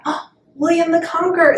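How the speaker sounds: high, excited pretend answer; mock sudden recall. Pupil answering a review question, acted excited. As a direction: blurt it out high as if just remembered. Acting a pupil who remembers.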